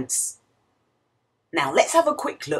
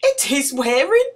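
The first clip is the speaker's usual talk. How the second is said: excited; 13 semitones higher, 5 dB louder than usual. Acted. Excited reading of picture book.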